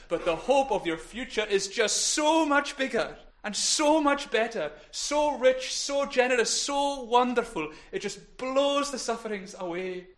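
loud, high, building emphasis on each "so"; impassioned preaching. Real, not acted. Preacher telling congregation of their future hope, impassioned preaching. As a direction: preach it with soaring conviction.

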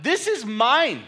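high, loud and slow: This is mine; retold shout. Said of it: "I actually shouted".

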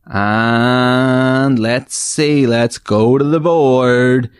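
slow, drawn-out host patter; game show host. Acted. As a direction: stretch it like a TV host. Host moves to board.

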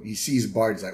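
normal talking voice

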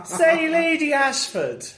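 gossiping with Lady Ashford in a high mock-Victorian voice. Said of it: "a very Victorian thing".

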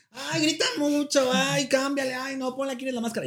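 high-pitched nagging señora; imitated housewife. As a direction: complain at the TV like a fed-up housewife. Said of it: "la señora en casita la voy a hartar, va a decir".

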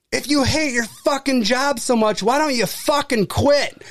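mock-furious, high-pitched taunt; retold angry parting shot. Customer's last shot before slamming the door, retold in angry imitation.

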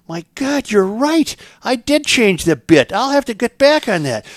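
high, amazed farmer voice. Acted. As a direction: gasp it out high, impressed.

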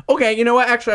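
high-pitched, heated and half laughing; passionate outburst. Real, not acted. Taster arguing coffee's bitterness with friends, passionate outburst. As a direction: jump up high and argue it with passion. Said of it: "You're so passionate".

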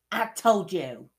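loud, high-pitched I-told-you-so; scolding Hermione. Acted. Hermione scolding, read aloud.

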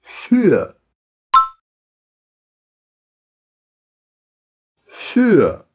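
"Für" with pursed lips, a U mixed with I; "Für" with a rounded front Ü; rounded umlaut Ü. Shown as a demonstration. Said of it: "Capricha no biquinho".

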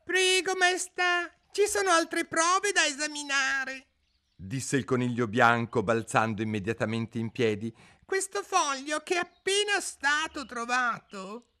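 very high, squeaky fussy rabbit; high-pitched rabbit herald in court. A narrator's reading. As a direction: chirp like a nervous little rabbit.

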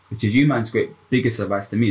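usual speaking voice

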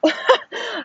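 high burst of laughter; real laugh. Real, not acted. Recalling no time for an epidural, high incredulous laugh. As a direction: laugh in disbelief at the memory.

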